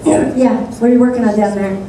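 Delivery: ordinary talk, nothing marked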